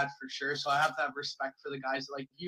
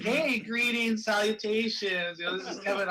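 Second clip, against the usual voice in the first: nicest-guy stepdad; 6 semitones higher, 4 dB louder than usual. Acted. Pitching a prank call as the stepdad, over-friendly. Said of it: "be, like, the nicest guy".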